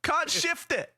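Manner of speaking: high put-on sly voice; scheming ladies. To clear the pool, a high mock sly complaint. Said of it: "they were like".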